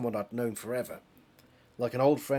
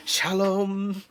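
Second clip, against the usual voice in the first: higher, louder hammed-up sitcom cry; Friday Night Dinner dad; 8 semitones higher, 6 dB louder than usual. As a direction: bellow it like the over-the-top sitcom dad. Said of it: "Reminds me of, um, Friday night dinner".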